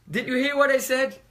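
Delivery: loud, high demanding shout; angry king. Acted. King shouting at bearers, loud and demanding. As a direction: bark the question at the bearers.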